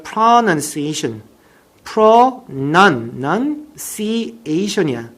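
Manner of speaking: pronunciation broken slowly into separate syllables. Shown as a demonstration. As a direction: model "pronunciation" very slowly, piece by piece. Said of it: "따라해".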